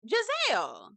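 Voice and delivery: normal talking voice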